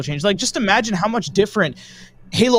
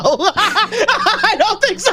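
laughing; 12 semitones higher, 3 dB louder, much slower than usual. Real, not acted. Doubting partner, laughing.

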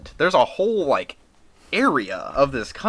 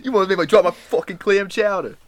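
higher hammed-up Boston complaint; angry Bostonian; 6 semitones higher than usual. Friend echoing the chowder rant, mock Boston accent. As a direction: whine like a Boston guy who nearly spilled his soup. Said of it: "Boston impression echoed".

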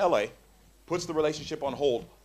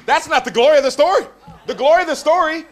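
much higher, louder triumphant proclamation; preaching climax; 8 semitones higher, 9 dB louder than usual. Real, not acted. Preacher revealing the story's glory, triumphant shout. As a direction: lift the crowd to the point.

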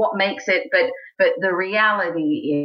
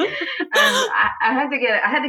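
laughing; 9 semitones higher than usual. Real, not acted. Repeats through laughter.

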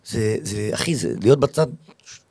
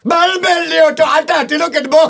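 singer impression; 14 semitones higher, 4 dB louder than usual. Comedian asked for singer impressions, high loud mock star voice. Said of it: "חיקויים של זמרים".

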